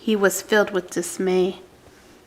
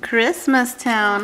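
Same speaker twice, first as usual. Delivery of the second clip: ordinary delivery, nothing marked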